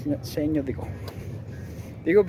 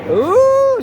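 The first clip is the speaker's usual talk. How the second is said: high, loud, drawn-out ooh; real burst of excited interest; 11 dB louder than usual. Real, not acted. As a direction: let out a curious, excited whoop. Spots something exciting going on.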